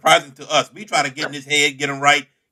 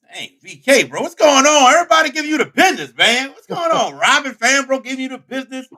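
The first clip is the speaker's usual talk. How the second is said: high, loud, gleeful ribbing; loud teasing; 11 semitones higher, 5 dB louder than usual. Real, not acted. Host teasing V K about getting roasted, loud and high. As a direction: rib your buddy loudly, voice way up.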